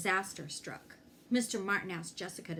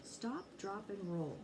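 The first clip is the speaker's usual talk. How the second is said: softly repeated; 9 dB quieter than usual. Shown as a demonstration. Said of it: "they were all saying it in class".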